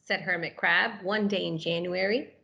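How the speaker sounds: animated picture-book narration; reading to children. A narrator's reading. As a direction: read it like it's story time for little kids.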